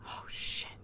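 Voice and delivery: hushed, whispered oh-no; whispered dismay. Retold or imitated. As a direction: whisper it as the problem dawns, play-acted. Acts out characters' worried realisation.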